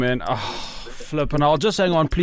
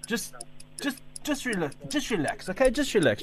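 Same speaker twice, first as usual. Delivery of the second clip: soft placating; 9 dB quieter than usual. Acted. Son soothing mom on phone.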